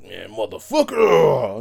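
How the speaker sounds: drawn-out, loud growled curse; acting out a boiling-over rage; livid. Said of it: "I'll be livid".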